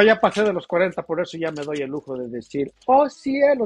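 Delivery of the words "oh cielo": "Oh, cielo" said higher, lilting and coy; playful oh cielo. Shown as a demonstration. Vendor showing how she likes saying oh cielo. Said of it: "Me gusta hacerle así".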